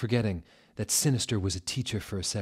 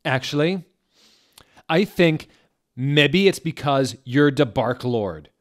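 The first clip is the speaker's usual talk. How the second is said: higher, louder angry comeback; defiant retort; 6 semitones higher, 9 dB louder than usual. A narrator's reading. Girl snapping back at the headmaster. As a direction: fire it back at him. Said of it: "I turned around angrily".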